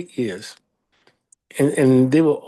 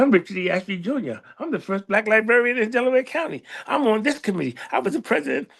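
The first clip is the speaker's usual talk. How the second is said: higher-pitched list of credentials, mildly affronted; retold self-important protest, pitched up; indignant boasting; 7 semitones higher than usual. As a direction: reel off your titles with mock indignation. Retelling his indignant boast to wife.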